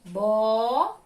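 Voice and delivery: "Bola" with a long held first syllable; separated syllables. Shown as a demonstration. Teacher separating the word for pupils, drawn out. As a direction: hold the first syllable of "Bola", pause, then the second. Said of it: "eu vou separar aqui".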